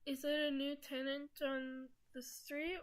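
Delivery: usual speaking voice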